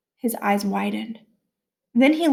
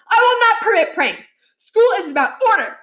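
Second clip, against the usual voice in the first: much higher, loud forbidding; stern proclamation; 11 semitones higher, 6 dB louder than usual. A narrator's reading. Principal declaring no pranks to the assembly, loud. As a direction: ban it with force.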